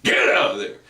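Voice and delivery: hard glottal bark; glottal shock. Shown as a demonstration. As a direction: air forced out hard from the throat. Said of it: "glottal shock comes across something like this".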